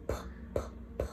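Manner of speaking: breathy whispered P, P. Shown as a demonstration. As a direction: whisper puffs of P. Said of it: "It's again the breath sound".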